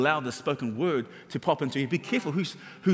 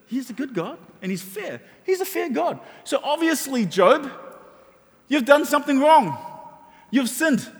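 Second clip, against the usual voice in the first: smug comforting friends; 6 semitones higher than usual. Acted. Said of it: "they come along to Job and they say".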